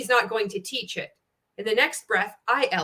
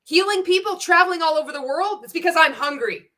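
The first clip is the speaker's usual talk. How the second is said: higher mock pitch voice; mocking imitation; 7 semitones higher, 3 dB louder than usual. Quoting the MLM guy to viewers, pitched-up mock. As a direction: parrot his hype, pitched up.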